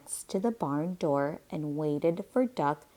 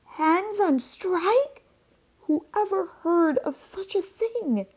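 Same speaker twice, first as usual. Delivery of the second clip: furious rant; 9 semitones higher, 5 dB louder than usual. A narrator's reading. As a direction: sputter it out in high-pitched outrage. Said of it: "Farmer Brown was furious".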